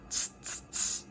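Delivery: soft unvoiced tss tss of cheeky monkeys; monkey tsk-tsk. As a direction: click it back like teasing monkeys.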